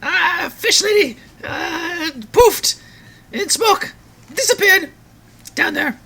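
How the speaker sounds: very high squeaky demon; invisible demon voice. Acted. Invisible demon tells the party what happened, high demon voice. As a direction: squeal it out in short bursts as a little demon. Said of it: "you hear this invisible demon voice say".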